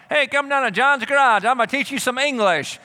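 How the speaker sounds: cheesy come-on voice; mock garage teacher. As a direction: play a dodgy garage salesman. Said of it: "don't go down to John's garage".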